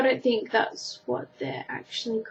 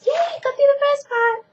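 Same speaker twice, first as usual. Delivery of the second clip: excited; 4 dB louder than usual. Real, not acted. Excited cheer after word list.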